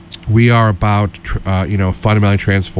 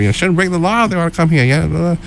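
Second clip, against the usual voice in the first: high, fast mock Southern voice; imitating a Southern politician, hammed; Southern accent; 9 semitones higher, faster than usual. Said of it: "I don't wanna do a Southern accent".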